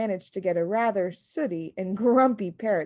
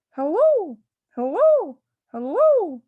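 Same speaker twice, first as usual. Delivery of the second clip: parrot calling; 11 semitones higher, 8 dB louder, slower than usual. Acted.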